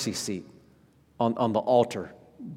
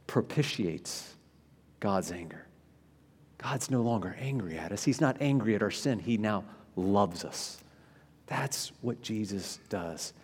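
hushed sincere close; 4 semitones lower, 10 dB quieter than usual. Real, not acted. Quiet climax of the point.

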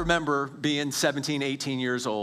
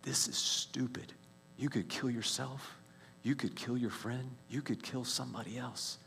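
hushed, breathy inner warning, much softer; inner voice whisper; 9 dB quieter than usual. Retold or imitated. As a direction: whisper the warning thoughts to yourself. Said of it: "these thoughts that had not occurred to me".

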